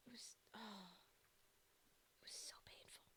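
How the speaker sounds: soft whispered anguish; pained whisper. Real, not acted. Streamer lamenting the lost cards to chat, pained whisper. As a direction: breathe it out like it hurts.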